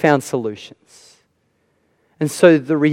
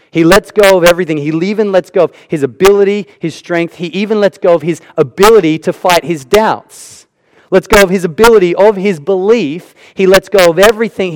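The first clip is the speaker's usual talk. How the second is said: fast, louder preaching, driving each phrase home; preaching at full force; 2 semitones higher, 4 dB louder than usual. Acted. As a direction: build the sermon to a climax, pressing on.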